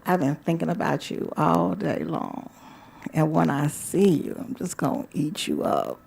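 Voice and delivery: soft, sinking seductive murmur. Acted. Telling a lover what awaits tonight, softly seductive.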